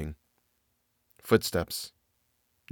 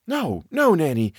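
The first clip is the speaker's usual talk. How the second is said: wild interruption; 8 semitones higher than usual. A narrator's reading. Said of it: "interrupted wildly".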